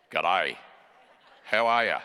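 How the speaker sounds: low, dropped Australian greeting; dropped Australian accent. Shown as a demonstration. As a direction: let the Australian greeting fall straight out, low. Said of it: "We just let it drop straight over".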